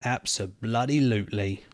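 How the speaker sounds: ordinary delivery, nothing marked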